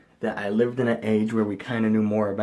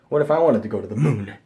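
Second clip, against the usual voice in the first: ordinary delivery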